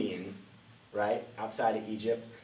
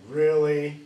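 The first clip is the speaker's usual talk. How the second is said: incredulous; 6 semitones higher, 11 dB louder than usual. Retold or imitated. Imagined Israelites doubting Moses.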